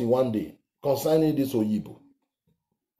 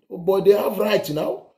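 ordinary delivery

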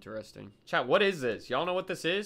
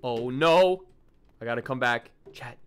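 ordinary talk, nothing marked

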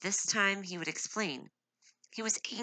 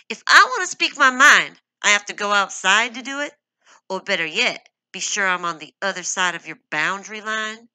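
higher and much louder, rising to a shout; growing anger; 5 semitones higher, 14 dB louder than usual. A narrator's reading. Angry wife challenging her husband, rising to a shout. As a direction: build the fury to his name. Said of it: "Her own anger was growing swiftly".

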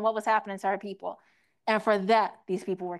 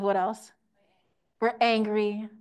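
ordinary delivery

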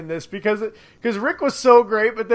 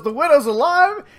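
mock Negan delight; 7 semitones higher, 4 dB louder, slower than usual. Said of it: "He goes".

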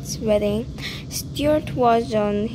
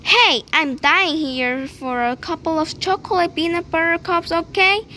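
loud demanding; 6 semitones higher, 4 dB louder than usual. Acted. Tooth shouts in class.

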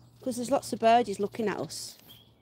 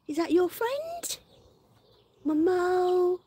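much higher, slower coo; cooing pet talk; 7 semitones higher, slower than usual. Real, not acted. Owner cooing to the dog about its friend.

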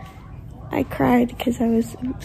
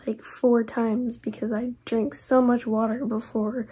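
ordinary delivery

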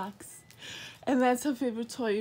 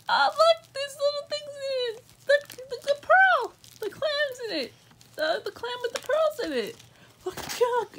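very high, cooing puppy-talk voice; squeaky-high delight; excited pet talk; 13 semitones higher than usual. Real, not acted. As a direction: coo over the toys for the dog. Showing toys to her dog.

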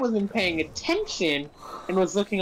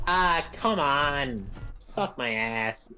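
put-on drunk Australian grumble; 6 semitones lower, 4 dB quieter, slower than usual. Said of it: "all I heard at first was like".

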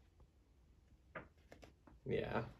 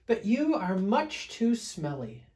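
higher, lilting storybook bear; voice from above; 10 semitones higher, 6 dB louder than usual. Acted. Fluffy bear up a tree to smelly bear, higher teasing call. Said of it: "said a voice from above".

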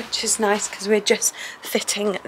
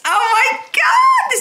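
thrilled squeal; 4 dB louder, slower than usual. Real, not acted. Sees her favourite print.

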